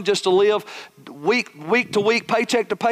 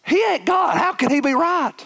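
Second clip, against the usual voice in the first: shocked; 10 semitones higher than usual. Retold or imitated. Pastor arguing with the Holy Spirit, shocked and incredulous. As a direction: recoil at what God said, incredulous. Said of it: "I'm like".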